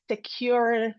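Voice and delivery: ordinary talk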